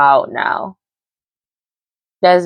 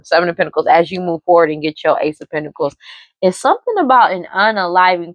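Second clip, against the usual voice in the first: ordinary talk, nothing marked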